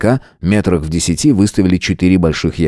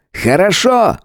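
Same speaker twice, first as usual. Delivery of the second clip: higher showman announcer voice; ringmaster; 12 semitones higher, slower than usual. Acted. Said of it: "конферансье".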